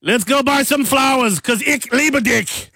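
high, strident mock-German declaration; harsh German lover. Host playing a German suitor offering flowers, harshly. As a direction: make romance sound like a military order.

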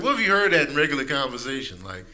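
ordinary talk

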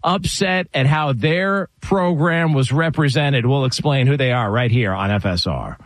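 ordinary delivery

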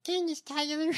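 high, put-on mimicking voice; mock politician voice. Co-host tries the name in the politician's voice, high mimicry. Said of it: "With that voice?".

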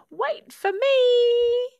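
high, loud drawn-out yell. A narrator's reading. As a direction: call desperately after the others, stretching it out. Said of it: "took a big run up and yelled".

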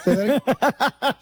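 half-laughing, slowly asks to hear it again; stunned disbelief. Retold or imitated. Stoned guy to rude clerk, high slow disbelief. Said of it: "And I go".

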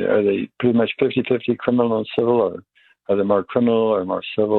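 ordinary talk, nothing marked

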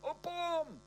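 shrill panicked scream, very high; high-pitched scream. Acted. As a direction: scream it in terror. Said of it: "at the top of my voice".

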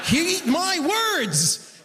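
very slow, high proclamation; mock solemn. Comedian warning the crowd, slow mock-solemn. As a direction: intone it like a prophet. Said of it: "mock solemn warning".

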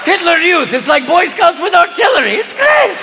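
high-pitched stoned excitement; loaded cardinals. Acted. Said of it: "getting a little loaded".

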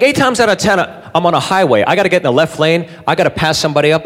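normal talking voice

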